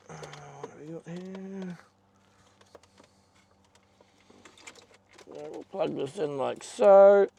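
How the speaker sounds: straining and grunting while working. Real, not acted. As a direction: grunt with effort mid-sentence. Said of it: "So excuse my grunting".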